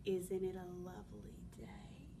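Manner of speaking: low, whispery sly wolf voice. Acted. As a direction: purr it like a sneaky wolf.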